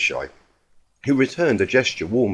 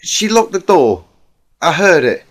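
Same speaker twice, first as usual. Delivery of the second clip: higher, louder worried mare's voice; voiced pony friend, upset; 8 semitones higher, 8 dB louder than usual. A narrator's reading. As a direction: blurt it out, rattled.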